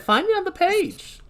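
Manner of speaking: quoting her in high New York voice; New Yorker. Acted. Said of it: "That's why she said".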